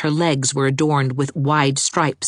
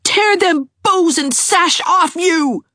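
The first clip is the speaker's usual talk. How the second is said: put-on high, louder furious child; high, louder enraged boy; maddened yell; 4 dB louder than usual. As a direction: stammer out a mad threat. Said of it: "yelled the maddened Gerald".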